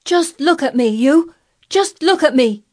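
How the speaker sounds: put-on high, loud, defiant boy; ringing, demanding boyish shout; flung-out challenge. A narrator's reading. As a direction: fling it upward, insisting hard. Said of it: "he flung up at Ben Weatherstaff".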